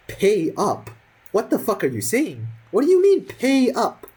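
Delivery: mock-outraged squeal of disbelief. Retelling answering the seniors who demand payment, outraged disbelief.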